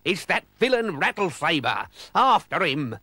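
rally the crew in character; pirate captain's battle cry. A narrator's reading.

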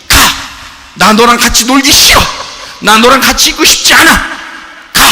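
loud and high, telling gloom to leave; shouted rejection. Acted. Preacher acting out telling pain to go away, loud shout. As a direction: bark it out to chase sadness off. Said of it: "이 말, 이 아포티나소예요".

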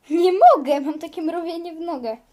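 very loud cry, then a fast rushed complaint; distressed cry. Pretend. Mother who can't stand cries out in distress.

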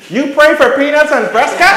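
very high, loud squawking woman's voice; mock shrill old lady. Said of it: "she goes".

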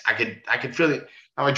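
plain reading voice

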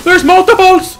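high, loud panicked yell; panicked shout. Real, not acted. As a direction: sound the alarm in panic. Panic at many enemies.

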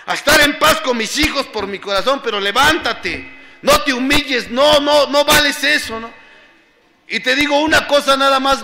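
high, loud, emphatic insistence; impassioned retelling of a confrontation; retold firm urging. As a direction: insist forcefully that they keep their dignity. Acts out telling her not to grovel.

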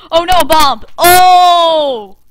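loud cry of dismay; alarmed shout. Real, not acted. Player spotting a bomb in the game, alarmed shout.

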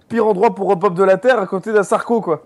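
normal talking voice

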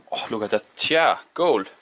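ordinary delivery

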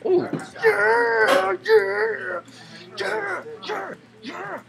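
very high cheers, fading from loud; squealed celebration. Real, not acted. Player celebrates a good swing, squealing triumph. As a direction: squeal each cheer in falsetto.

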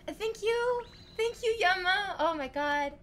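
high, loud, thrilled thanks; real overjoyed thanks; excited gratitude. Real, not acted. Thanking the donor.

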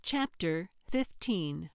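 plain reading voice

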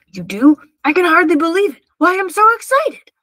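loud, rising burst of delight; overjoyed rhinoceros, voice climbing high; excited. A narrator's reading. Rhinoceros thrilled at promised help.

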